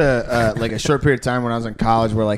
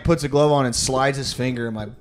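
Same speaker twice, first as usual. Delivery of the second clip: ordinary talk, nothing marked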